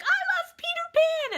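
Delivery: put-on very high, loud childlike cry; Owen's excited shout. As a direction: imitate an excited kid calling out. Retells son shouting to the guys.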